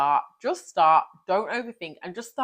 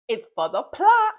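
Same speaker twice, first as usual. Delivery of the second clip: slow catchphrase; 8 semitones higher, 7 dB louder than usual. Retold or imitated. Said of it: "I heard this from a creator on TikTok".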